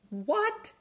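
squeaky, high disbelieving what; incredulous what. Acted. Pastor reacting to Jonah's complaint, high incredulous what.

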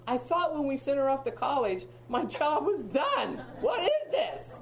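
hammed-up frustrated shout, pitch jumping high at the end; mock exasperated outcry. Mother complaining to the grandmother, mock exasperated shout. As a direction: play up the parent's comic dismay. Exasperated parent of adult daughter.

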